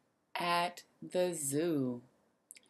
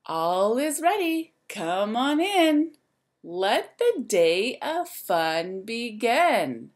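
lively, sing-song storybook voice; reading to children; 2 semitones higher, 6 dB louder, slower than usual. A narrator's reading. Teacher reading a rhyme book to the class, lively and sing-song. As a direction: wake the zoo up like a bright morning call.

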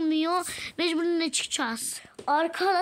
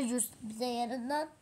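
normal talking voice

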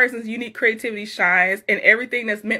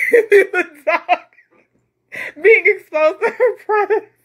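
laughing; 10 semitones higher, 4 dB louder than usual. Real, not acted. Reacting to a viewer's funny comment.

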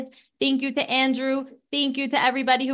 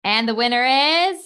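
booming prize announcer; 3 semitones higher, 5 dB louder than usual. Acted. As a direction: announce the winner like a host. Announcing raffle winners.

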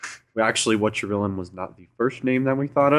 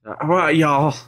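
substitute teacher; 7 semitones higher than usual. Retold or imitated. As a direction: play the tough Key and Peele teacher. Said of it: "Remember that video with Key and Peele".

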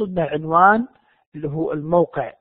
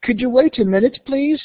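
higher, courteous request with rising end; more polite; 6 semitones higher, slower than usual. Shown as a demonstration. Receptionist asks the visitor to wait a minute, polite rising request. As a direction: ask politely, lifting "please". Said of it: "بأسلوب أكثر تهذيبًا".

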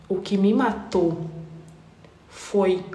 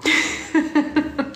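high airy laugh; real laugh; short burst of laughter; 6 semitones higher than usual. Real, not acted. Laughing at the memory.

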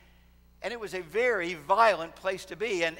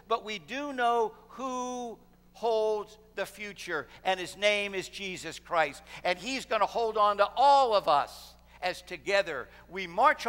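full-force preaching; 4 semitones higher, 5 dB louder than usual. Real, not acted. Preacher rallies congregation to march off the map, impassioned climax. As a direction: preach it out big, building to a ringing close.

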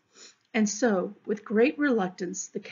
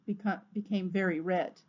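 ordinary delivery, nothing marked

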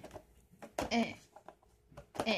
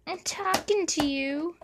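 higher, louder insisting; higher pitched reproach to the cat; scolding; 7 semitones higher, 4 dB louder than usual. Real, not acted. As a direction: demand the cat's attention. Still telling off the cat.

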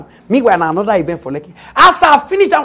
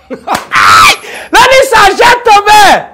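drawn-out, much louder and higher announcer call; loud slow call; 11 semitones higher, 9 dB louder, much slower than usual. Acted. Calling out to the audience like an MC, slow loud hail. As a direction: stretch it out like hailing a packed hall.

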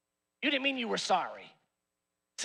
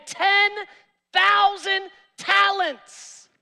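preaching shout; 8 semitones higher, 6 dB louder, much slower than usual. Real, not acted. Preacher stressing huge debt.

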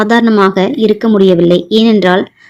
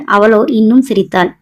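usual speaking voice

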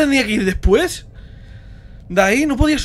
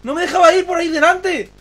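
higher-pitched, aggrieved complaint; indignant protest; 7 semitones higher than usual. Real, not acted. Streamer complaining the game blocked the way, indignant. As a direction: protest that it was unfair.

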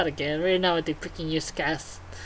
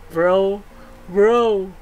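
loud bro call; 5 dB louder than usual. Acted. Hammed-up buddy dialogue read.

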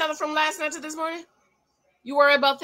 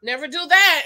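exclaimed warning, louder; 3 semitones higher, 8 dB louder than usual. Real, not acted. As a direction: call it out as an urgent warning. Warning the man on screen.

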